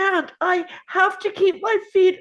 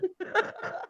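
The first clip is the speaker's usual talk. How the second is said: breathy, delighted laugh; joyful laugh; 11 dB quieter than usual. Acted. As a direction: laugh with pure joy. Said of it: "laughing with joy".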